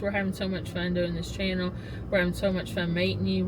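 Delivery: usual speaking voice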